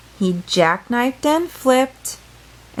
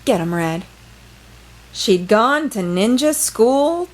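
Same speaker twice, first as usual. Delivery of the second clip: ordinary delivery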